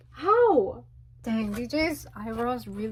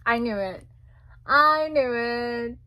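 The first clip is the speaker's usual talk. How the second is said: ordinary delivery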